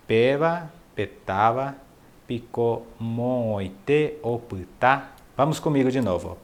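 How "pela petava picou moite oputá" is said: slow, syllable by syllable, with a pause mid-phrase; slower. Shown as a demonstration. Teacher slows the Guarani phrase so students can follow, very slow. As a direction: break "Pela petava" from "picou moite oputá" and stretch every syllable. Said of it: "Ó, eu vou falar mais devagar".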